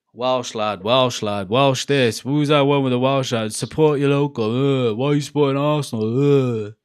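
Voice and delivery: lightly mock a whining troll; mocking online commenter. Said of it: "one of those comments, like".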